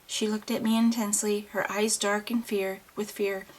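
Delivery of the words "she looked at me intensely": ordinary delivery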